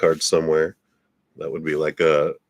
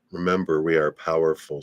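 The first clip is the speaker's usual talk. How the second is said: normal talking voice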